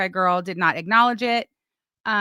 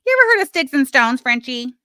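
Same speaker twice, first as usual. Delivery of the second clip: louder, higher and faster, jabbing at Frenchie; raised taunting challenge; loud taunting repeat; 4 semitones higher, 7 dB louder than usual. Real, not acted. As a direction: call it out to her, pushed up and quick.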